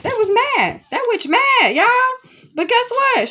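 gleefully outraged, almost squealing; giddy high rant. Real, not acted. Telling viewers spirits burned her notebook, squeaky excited disbelief.

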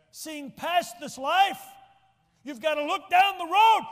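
soaring loud slow preacher cry; preaching surge. Real, not acted. Preacher urging the congregation, soaring loud and slow. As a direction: lift it high and drag it out to the back pew.

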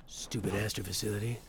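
a lightly gruff, Geralt-ish hero voice, a hint of it only; Pathfinder voice. Player voicing his Pathfinder like Geralt, understated hero voice. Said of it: "I guess this is my Pathfinder voice".

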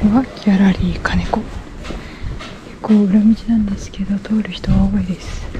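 shy whisper so passersby won't hear. Real, not acted. Vlogger embarrassed by passersby on the street, whispering. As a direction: whisper it, embarrassed by the people walking past. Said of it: "小声の小声だし".

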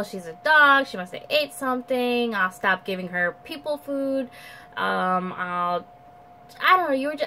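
usual speaking voice

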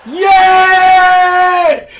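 big loud celebratory yeah; victory yell. Real, not acted. Gamer celebrating a dunk on screen, loud yell. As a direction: let it rip after the dunk.

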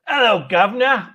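mock British greeting in broad Cockney; Richard. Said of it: "here's my best Richard impression".

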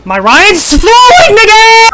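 high, loud, drawn-out shout; stretched-out screaming complaint; outraged gamer yell. Real, not acted. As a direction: holler it at the top of your voice. Player yelling at teammate's play.

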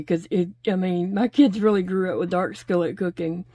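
usual speaking voice

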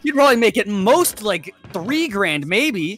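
high, loud mocking rant; mock scolding rant. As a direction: scoff at them loudly. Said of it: "And I was sitting there like".

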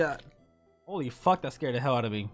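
ordinary talk

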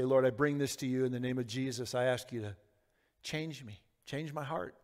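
soft, low plea to God; hushed, humble petition; quiet prayer. Acted. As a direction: pray gently, fading almost to a hush. Models a closing prayer.

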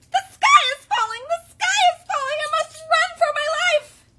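very high, loud panicky cry; frantic little chick raising the alarm; Chicken Little. A narrator's reading. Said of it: "he exclaimed".

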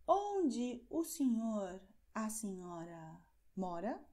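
slow, very deliberate polite question; teacher contrasting the formal form with the informal one; formal model question. Shown as a demonstration. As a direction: ask it slowly and politely. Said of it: "Vamos ver um exemplo".

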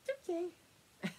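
high, very soft cooing to the dog; whispery high comfort for a skittish dog; soothing pet talk. Real, not acted. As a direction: hush the nervous dog gently. Soothing dog while patting.

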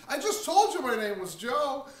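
mock the annoyed caller while laughing; laughing indignant quote. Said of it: "I've even had people say to me".

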